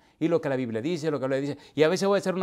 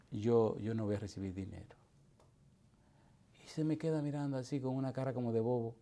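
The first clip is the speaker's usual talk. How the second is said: ordinary talk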